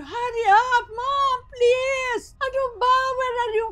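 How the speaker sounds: high, loud sobbing hurry up; crying plea. Retold or imitated. Daughter crying to mother over the phone, sobbing. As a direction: cry out desperately for mom to come.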